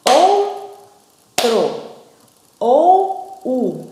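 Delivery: ou and u stretched out loud, syllable by syllable; teacher sounding out vowels; syllable demo. Shown as a demonstration. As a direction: sound out each vowel slowly for the class. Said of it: "Numa sílaba só, são duas vogais".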